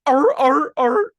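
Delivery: high, loud seal barks "ar, ar, ar". Shown as a demonstration. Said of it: "I sound less like a seal".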